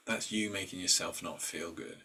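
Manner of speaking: ordinary talk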